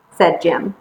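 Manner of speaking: usual speaking voice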